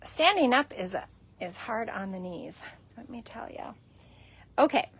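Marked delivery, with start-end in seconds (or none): 3.0-3.7 breathy whisper aside. Real, not acted. Vlogger joking about her knees, whispered aside. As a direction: drop to a whisper.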